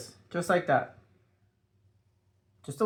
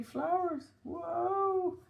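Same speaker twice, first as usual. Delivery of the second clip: mock wonder; 12 semitones higher, 10 dB quieter, much slower than usual. Mock amazement at drawn flowers.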